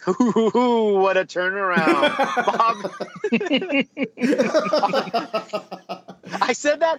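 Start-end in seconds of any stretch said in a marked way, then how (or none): 0.1-2.2 smug. Pretend. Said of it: "I tried to sound like a smug". 2.2-6.4 laughing. Real, not acted. Laughing after smug line.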